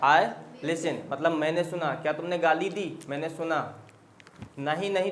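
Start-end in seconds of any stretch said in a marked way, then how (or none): none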